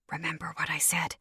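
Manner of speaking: soft unvoiced whisper. Hugging her friend goodbye, secret whisper in her hair. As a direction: whisper it close to her ear. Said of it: "before whispering Spanish into her lavender hair".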